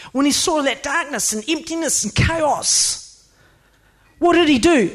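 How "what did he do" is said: normal talking voice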